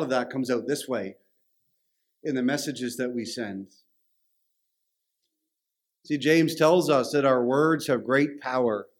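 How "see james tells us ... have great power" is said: normal talking voice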